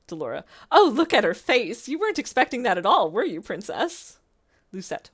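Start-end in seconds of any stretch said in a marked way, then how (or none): 0.7-4.2 gloating. Acted. Delora gloating at princess.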